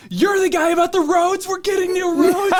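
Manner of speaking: put-on high, loud squeaky kid voice; excited kids. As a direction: mimic an eager eleven-year-old. Kids voiced in retold story.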